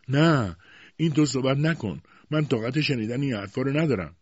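high, urgent begging; pleading. A narrator's reading. Wife shaken by her husband's threat, pleading. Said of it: "به التماس افتاد".

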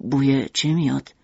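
husband refusing to rest, low and firm; stubborn father. Acted. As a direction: refuse firmly, pitched low. Mishima voice.

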